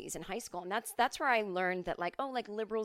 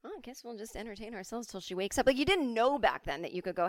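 ordinary talk, nothing marked

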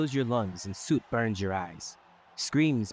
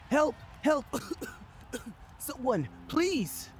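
weak trapped cry for help; slower than usual. Acted. Trapped man calling for help.

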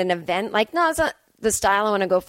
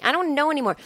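pitched up, at full voice; higher-pitched giving up; exasperated repeat; 5 semitones higher, faster than usual. Real, not acted. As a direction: throw it out helplessly, higher.

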